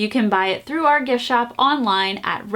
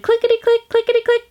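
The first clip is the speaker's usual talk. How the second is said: high, tiny clickety-click; squeaky toy-train chant; little train voice; 11 semitones higher, faster than usual. A narrator's reading. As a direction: make it sound like a tiny train.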